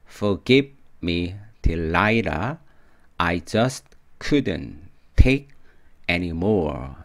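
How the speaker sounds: very slow, phrase by phrase; measured word-by-word delivery with clear gaps; slow model reading. Shown as a demonstration.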